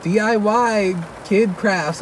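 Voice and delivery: slow, drawn-out mock announcement; slow, unimpressed reading of a video title; slow deadpan listing. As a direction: spell each word out slowly, unimpressed. Listing silly video feed.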